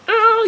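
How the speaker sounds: sharp high cry of pain. Retold or imitated. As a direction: yelp out a high oh. Retold pained cry.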